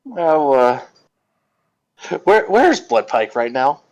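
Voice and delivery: usual speaking voice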